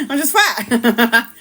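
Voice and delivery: giggling through the words; laughing. Real, not acted. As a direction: laugh it out slowly. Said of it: "laughing at herself".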